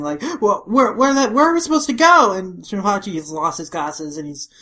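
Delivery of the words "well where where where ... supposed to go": high, loud, flustered stammer; panicking. Acted. Crew with nowhere to escape the blast, panicking. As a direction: act out frantic confusion. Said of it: "like, panicking".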